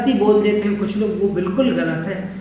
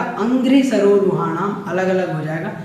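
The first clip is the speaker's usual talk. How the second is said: usual speaking voice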